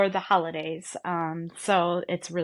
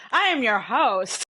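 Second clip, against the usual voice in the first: higher, louder spooky host line; Ghost Host voice; 4 semitones higher, 4 dB louder than usual. Shown as a demonstration. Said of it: "Your ghost host".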